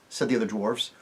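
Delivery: plain reading voice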